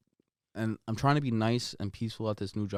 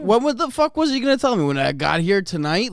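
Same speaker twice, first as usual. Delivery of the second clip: loud, higher incredulous rant; retold angry outburst; 10 semitones higher, 10 dB louder than usual. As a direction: yell it in disbelief.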